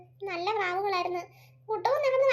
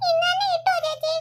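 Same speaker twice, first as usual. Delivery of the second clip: put-on high, small child's voice; little boy voice; 10 semitones higher than usual. Young son answering his mother, high childlike voice. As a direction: play the young son, eager and sweet.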